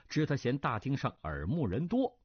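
ordinary delivery, nothing marked

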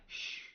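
soft, sharp shush; hushing shh. Acted. Wukong silences Bajie before opening the lock, hushing. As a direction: hush a companion in secret.

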